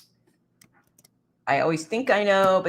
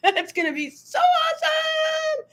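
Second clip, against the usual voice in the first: high squeal of tearful delight; squealing with joy. Real, not acted. Host squeals happily at a friend's good news, high and teary. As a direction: squeal it out, near tears, thrilled.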